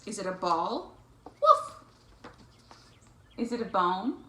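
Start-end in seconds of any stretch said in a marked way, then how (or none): 1.2-1.7 high, loud little dog bark; puppy woof. Acted. Reader voicing Biscuit the puppy for children, high woofs. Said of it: "woof like Biscuit".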